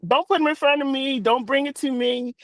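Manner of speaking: ordinary talk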